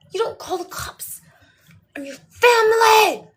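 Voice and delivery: high, full-volume hammed-up shriek; outraged screaming. Mother forbidding son to call cops on family, outraged screaming. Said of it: "So I was just screaming back".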